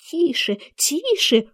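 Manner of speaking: high, slow shushing; anxious hushing. A narrator's reading. Rabbit stops Alice laughing, anxiously hushing. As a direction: hush her, high and drawn out. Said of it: "остановил её кролик".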